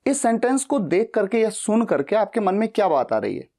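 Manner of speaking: ordinary talk, nothing marked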